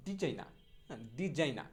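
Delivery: ডিজাইনা ending in open "naa", no r; corrected pronunciation. Shown as a demonstration. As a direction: drop the final r of ডিজাইনা. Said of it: "সঠিক উচ্চারণটা হবে হচ্ছে".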